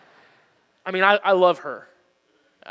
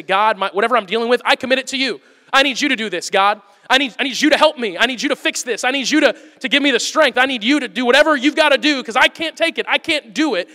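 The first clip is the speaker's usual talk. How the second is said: louder, higher, insistent plea to God; urgent pleading prayer; 5 semitones higher, 6 dB louder than usual. Acted. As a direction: plead urgently, handing it all over.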